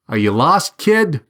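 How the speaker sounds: lightly voiced policeman, pitch jumping up on the last word; challenging policeman. A narrator's reading. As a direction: question the student with a hint of authority. Policeman confronts a startled student.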